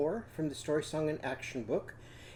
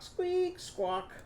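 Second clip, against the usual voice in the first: very high squeak then squawk, fading softer; squeaky creaking joints; 3 dB quieter than usual. Retold or imitated. Teacher imitates the rusty Tin Man's joints, high squeaky. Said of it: "joints got rusty and squeaky".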